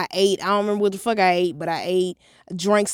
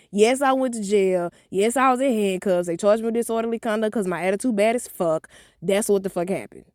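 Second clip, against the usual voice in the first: ordinary talk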